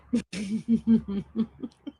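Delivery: long, soft run of giggles; helpless laughter. Real, not acted. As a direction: giggle on and on, guts aching. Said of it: "That hurts my guts".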